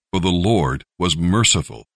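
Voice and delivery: ordinary delivery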